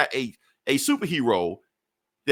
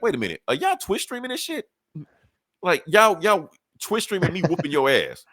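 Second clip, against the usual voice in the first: high-pitched mock disbelief; incredulous; 6 semitones higher than usual. Iron Man confronting his attackers on stream, incredulous. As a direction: act out an indignant double take.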